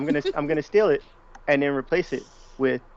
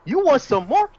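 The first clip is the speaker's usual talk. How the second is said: louder taunt; 12 semitones higher, 3 dB louder than usual. Acted. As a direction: taunt rising high. Taunt escalates, higher.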